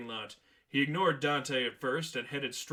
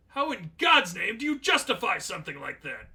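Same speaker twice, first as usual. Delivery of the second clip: higher, louder appalled demand; outraged; 6 semitones higher, 7 dB louder than usual. Acted. Manager outraged at the law.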